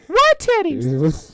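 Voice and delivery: high, slow child impression; little kid. Quoting the little kid from the movie, high drawn-out question. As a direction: stretch it out in a high, puzzled kid voice. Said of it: "he go, she goes".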